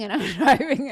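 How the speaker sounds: real laugh mid-sentence. Real, not acted. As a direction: giggle while telling it.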